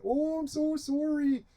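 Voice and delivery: very high, soft, drawn-out Canadian apology; exaggerated Canadian accent. Canada apologising to Native people, hammed-up Canadian accent. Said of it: "That's my Canadian accent".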